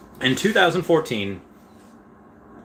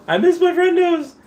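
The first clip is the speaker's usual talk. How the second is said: squeaky high voice, louder; silly high whine; 6 dB louder than usual. Acted. Streamer to chat, whining high about missing friends. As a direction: whine it like a kid missing pals.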